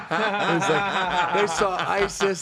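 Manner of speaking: high laughter bubbling through the words; laughing. Real, not acted. Telling his hosts the funny story, laughing high and loud.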